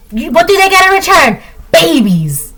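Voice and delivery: playful rapper impression with a high question. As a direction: ask it up high then drop the punchline.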